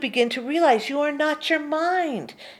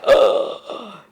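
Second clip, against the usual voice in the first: sharp startled gasp; mock gasp; 2 semitones lower, 8 dB louder than usual. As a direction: gasp as the shocked mind. The mind reacting.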